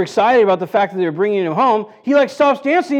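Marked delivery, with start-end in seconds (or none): none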